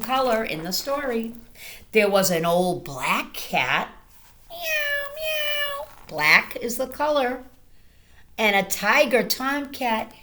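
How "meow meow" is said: very high meowing cat. Acted. Reading to children, a high cat meow. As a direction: meow like a kitty. Reading farm animal noises.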